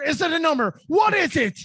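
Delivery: high, loud hammed-up grilling; mock interrogation shout. Host grilling a designer for answers, frantic mock interrogation.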